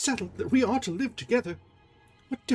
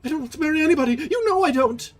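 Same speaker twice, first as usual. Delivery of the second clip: much higher, louder cry; desperate protest; 8 dB louder, faster than usual. Acted. Christine protesting to Erik, desperate. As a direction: insist in panic.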